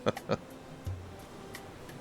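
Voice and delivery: soft, breathy chuckle; quiet chuckle. Acted. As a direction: laugh softly under your breath. Amused talk about the sister.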